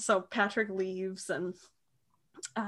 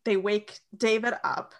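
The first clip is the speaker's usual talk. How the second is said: ordinary delivery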